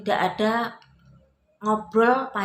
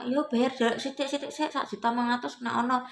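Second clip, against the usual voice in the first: debt collector; 7 semitones higher than usual. Retold or imitated. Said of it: "intinya dep kolektor minta".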